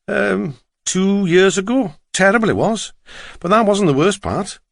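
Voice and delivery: high-pitched, lilting Welsh driver; Welsh accent. A narrator's reading. Driver recalls the drowning for passenger, Welsh accent. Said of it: "his strong Welsh accent".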